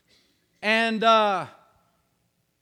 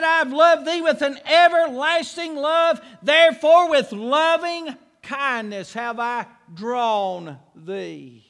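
verse quoted with rising force, then hushed; preacher quoting scripture with swelling force; preached scripture; 3 semitones higher, 4 dB louder, slower than usual. Acted. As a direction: build the verse up then let it fall.

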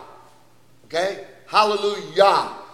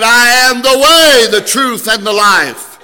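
loud proclamation; 5 semitones higher, 10 dB louder than usual. Acted. Preaching Jesus' sayings.

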